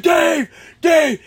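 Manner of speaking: high, loud shout through a door; retold urgent yell; urgent calling out. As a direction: holler to wake him, acted out. Retells kicking door, calling friend.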